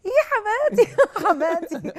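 high, loud delighted cry with a laugh; excited. Real, not acted. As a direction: squeal it out happily, laughing. Delighted cry naming mother-in-law.

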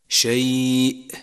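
شيء drawn out slowly, cut off by a glottal stop; slow شيء ending in a sharp catch; final hamza stop. Shown as a demonstration. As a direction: stretch the glide in شيء, close on a clear hamza. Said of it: "إن وقف عليها ساكنة".

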